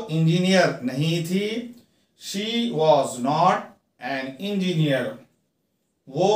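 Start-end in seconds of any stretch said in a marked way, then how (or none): 2.2-5.0 very slow, each word apart; slow teaching read. Shown as a demonstration. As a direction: dictate it slowly to students. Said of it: "teacher reads model sentence".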